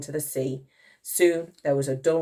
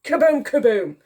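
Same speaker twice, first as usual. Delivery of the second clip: high kaboom; explosive boom; 12 semitones higher, 6 dB louder than usual. A narrator's reading. Reader sounding another hit, explosive. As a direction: pop it out like a blast.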